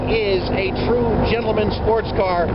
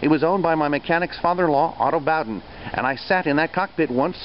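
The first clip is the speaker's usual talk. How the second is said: documentary voiceover; 7 semitones lower than usual. A narrator's reading. Voiceover narration of car history.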